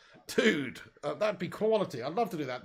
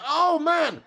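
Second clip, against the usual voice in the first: loud, high exasperated cry; frustrated outburst; 12 semitones higher, 8 dB louder than usual. Real, not acted. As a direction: let the frustration burst out. England under pressure, groaning.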